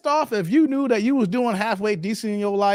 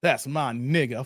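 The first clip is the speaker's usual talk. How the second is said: lower, softer mocking quote; 8 semitones lower, 4 dB quieter than usual. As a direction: sneer it as someone you despise. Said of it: "Only our dumbasses".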